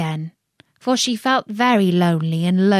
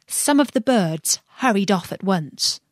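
plain reading voice

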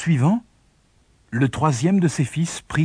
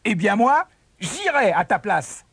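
cocky, higher-pitched boast; sneering; 6 semitones higher than usual. A narrator's reading. Younger brother offering to go instead, sneering. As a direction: boast with a mocking edge. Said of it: "ricana son cadet".

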